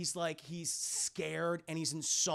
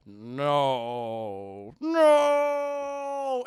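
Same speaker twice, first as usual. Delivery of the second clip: Vader's added no; 3 semitones lower, 9 dB louder than usual. Retold or imitated. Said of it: "do the whole".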